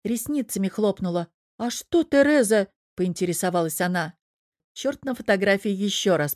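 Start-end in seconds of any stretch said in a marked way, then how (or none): 1.6-2.7 offended surprise. Acted. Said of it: "удивлённо, оскорблённо".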